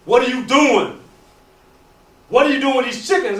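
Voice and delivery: loud, demanding challenge; angry shout. Acted. King confronting the eagles, angry shout.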